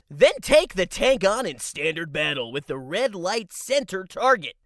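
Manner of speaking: upbeat pinball announcer read. Acted. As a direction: pitch the game like an announcer. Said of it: "drop my announcer voice".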